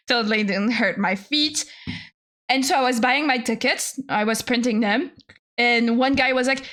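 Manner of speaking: normal talking voice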